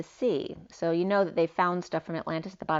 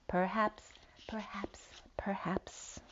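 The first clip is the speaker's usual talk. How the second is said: very soft, fading into a whisper; hushed repetition; 3 semitones higher, 9 dB quieter, slower than usual. Real, not acted. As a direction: murmur it to yourself, down to a whisper. Hushed repeats while reading.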